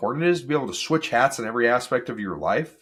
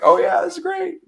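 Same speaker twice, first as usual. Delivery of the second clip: much higher, louder gushing; mock delight; 5 dB louder than usual. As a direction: gush like a thrilled listener. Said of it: "and you're like".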